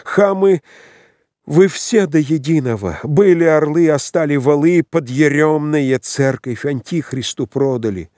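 high, heated denunciation; furious cry. A narrator's reading. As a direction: hurl insults at them all in a rage. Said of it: "крикнул царевич яростно".

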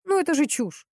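involuntary blurt. A narrator's reading. Investigator blurts disbelief at the love charm. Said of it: "Помимо воли вырвалось у него".

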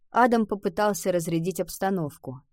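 ordinary delivery, nothing marked

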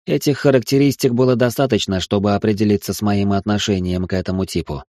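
plain reading voice

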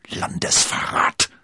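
loud, slow, voiceless outburst; forced-out accusation. A narrator's reading. Cardinal throws the charge at D'Artagnan, thrust out. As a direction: push the word out hoarsely. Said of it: "stieß der Kardinal hervor".

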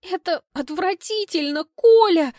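high, loud exclamation cracking on Коля; disgusted wail that cracks at the end; voice breaking. Acted. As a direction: cry it out as your voice breaks. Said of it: "восклицаю срывающимся голосом".

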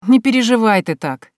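lightly smirking retort; wry amusement. Acted. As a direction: needle him with mock reassurance. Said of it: "усмехнулась я".

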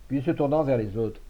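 ordinary delivery

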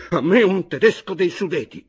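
loud, high, abrupt outburst; sudden shout. A narrator's reading. Chojnicki demanding a Sudeten German, sudden shout. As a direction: burst out shouting. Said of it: "gridò di nuovo all'improvviso".

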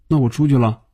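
plain reading voice

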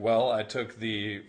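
slow, drawling mock Californian accent; Californian sketch accent. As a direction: draw out every word like a spoofed Angeleno accent. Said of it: "they just talk with that bizarre accent".

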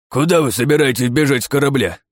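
put-on high, anxious old professor; a scared mad-scientist type; frightened old scientist. A narrator's reading. (испуганный старый учёный)

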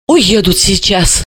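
ordinary delivery

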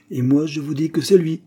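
flatly certain detective; absolute certainty. A narrator's reading. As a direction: state it as settled fact. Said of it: "sur un ton d'absolue certitude".

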